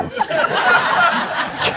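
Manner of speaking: a burst of airy laughter up high; high breathy laugh. Real, not acted. Pastor laughs at his own sarcastic reply, airy. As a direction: laugh at your own joke.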